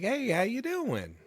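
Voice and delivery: put-on high-pitched gushing at a little kid; talking to a small child, sing-song and high; sweet talk to a child. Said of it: "I'm just like".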